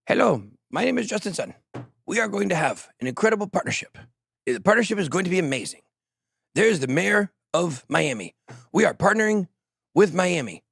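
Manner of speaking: imitation Justin Sun in a muddled Indian-to-Cuban accent; shifting foreign accent. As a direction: put on a vague accent that slides into Cuban. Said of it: "shift from an Indian accent to a Cuban accent".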